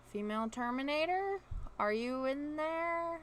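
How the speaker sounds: slow, high call through a door. Acted. Calling through the bathroom door.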